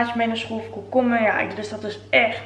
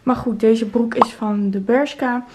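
normal talking voice

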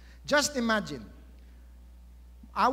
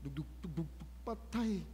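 soft imitated heartbeat thumps; heartbeat imitation; 2 semitones lower, 10 dB quieter, much faster than usual. Shown as a demonstration. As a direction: mimic a pounding heart, quietly. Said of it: "inwardly palpitating".

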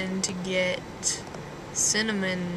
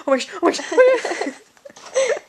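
excited squeal; 10 semitones higher, 8 dB louder, slower than usual. Retold or imitated. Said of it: "I was like".

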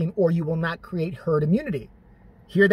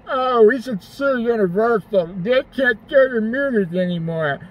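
slow, dopey mock CEO voice, a bit louder; dim CEO; 3 semitones higher, 3 dB louder, slower than usual. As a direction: play a brain-damaged CEO, slow and dumb. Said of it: "put them back in their C E O suit and said".

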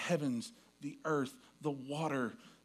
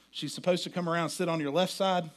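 normal talking voice

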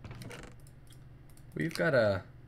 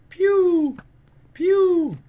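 high, loud mouth-made laser pews; kid-like pew pew blaster sounds; pew sound effects; 10 dB louder than usual. Pretend. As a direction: fire off two playful shot noises. Mimicking his bow shots.

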